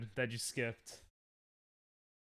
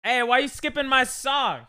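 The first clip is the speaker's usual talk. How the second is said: mock protest; 7 semitones higher, 11 dB louder than usual. Real, not acted. Song skipped, protest.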